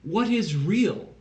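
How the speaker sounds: very slow, swooping questions; drawn-out, pseudo-profound pondering; mock-philosophical musing. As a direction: ponder each word with exaggerated wonder. Mock deep questions posed after silence.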